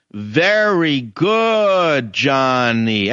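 mock praise with long drawn-out vowels; gushing parental praise. Parent praising a child at the restaurant table, gushing mockingly. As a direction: stretch it out slowly like an over-encouraging parent.

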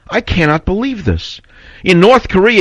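high, rising indignation; growing anger. Real, not acted. Host ranting about liberals, angrily pitched high. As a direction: let the outrage spike up. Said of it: "I started to get angry".

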